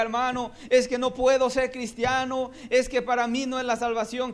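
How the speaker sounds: doubter's whine. Acted. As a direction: mimic a reluctant doubter. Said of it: "alguien que diga".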